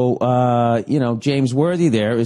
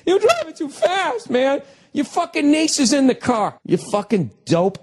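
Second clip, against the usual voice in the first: much higher, cracking outraged yell. Retold or imitated. Host ranting at a TV driver, voice cracking high. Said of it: "My fucking voice cracking".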